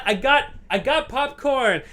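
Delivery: high, soft sheepish repeat; timid high repeat. Retold or imitated. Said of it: "I said".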